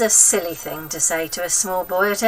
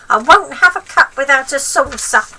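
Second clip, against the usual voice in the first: put-on high, loud cranky old woman; bossy wicked granny, pitched up and loud; grandma voice; 7 semitones higher, 10 dB louder than usual. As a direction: nag the boy like a spiteful old grandma.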